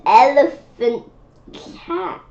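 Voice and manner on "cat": higher, softer reveal of "cat"; surprise reveal. Acted. Child reading the elephant cat reveal, high and light. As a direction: pop the last word up high.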